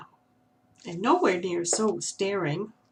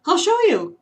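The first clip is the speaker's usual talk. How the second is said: higher, louder owl voice; playful owl; 9 semitones higher, 8 dB louder than usual. A narrator's reading. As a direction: tease the squirrel with a promise. Owl offers a trick.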